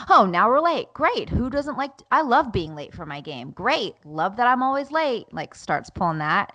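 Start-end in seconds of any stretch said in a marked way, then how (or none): 0.1-5.3 high, swooping mock-delight at being late; sarcastic son. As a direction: mimic a sulky child's sarcasm. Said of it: "Then Levi's like".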